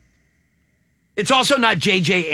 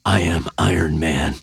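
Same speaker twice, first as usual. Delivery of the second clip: very low, growling superhero voice; deep, rough superhero impression; Iron Man voice; 12 semitones lower than usual. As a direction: drop way down into a deep hero growl. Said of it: "that's not my Downey voice".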